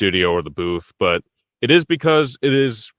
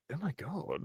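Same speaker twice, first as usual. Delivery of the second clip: very soft, higher-pitched imitation of amazement; hushed awe; 7 semitones higher, 18 dB quieter than usual. Amazed listener reacting to a grand voice, hushed and high. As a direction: act stunned, hardly any volume.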